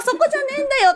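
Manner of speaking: high, laughing "そこじゃねえんだよ" repeated, still in disbelief; laughing exasperation. Retold or imitated. Speaker repeating her inner retort to the staff, high laughing exasperation.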